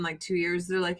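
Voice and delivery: ordinary talk, nothing marked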